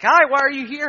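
high, bright-voiced shelter worker; chirpy staff greeting. Shelter staff greeting the returning adopter, in a high surprised voice. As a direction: play the surprised staffer, pitched high and loud.

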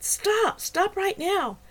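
loud, very high urgent command; shouting. A narrator's reading. Woman shouting at two fighting men to stop. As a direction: yell to break up the fight. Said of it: "I shouted".

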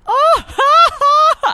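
loud high cackling laugh; imitated laugh. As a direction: do her big self-amused laugh. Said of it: "her laugh when she laughs at her own joke".

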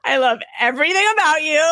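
high-pitched, laughing fan gush. Retold or imitated. Fan interviewing her idol company's head, gushing and laughing. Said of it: "this is me interviewing [name]".

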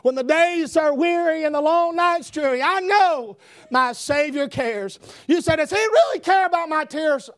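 full-force preaching. Real, not acted. Preacher assuring the weary that the Savior cares, full-force preaching. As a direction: lift it high and press on.

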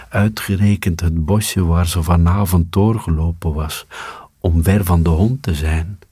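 plain reading voice

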